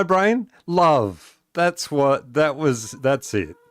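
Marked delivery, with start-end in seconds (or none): none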